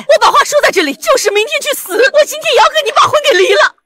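high-pitched, heated vow; impassioned, high-strung defiance; defiant declaration. Acted. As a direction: lay down an ultimatum. Wife vows to divorce today.

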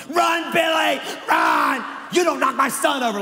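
loud, high hammed-up yelling at the field; mock sideline mom. Imitating a parent screaming at a kids' football game, loud mock yell. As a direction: shout like a frantic football mom.

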